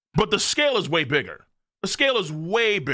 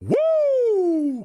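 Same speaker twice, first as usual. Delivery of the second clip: hype cheer; 13 semitones higher than usual. Real, not acted. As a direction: cheer it out to the chat.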